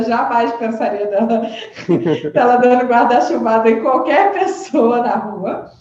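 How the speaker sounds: talking through real laughter about the friend; amused, words breaking into laughs; laughing. Real, not acted. Laughing while telling of the friend who hit a thief.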